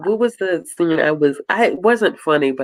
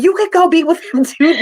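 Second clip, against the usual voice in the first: higher, louder mock retort; mock Alana retort; 5 dB louder than usual. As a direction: fire it back like Alana, half laughing. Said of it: "And she's like".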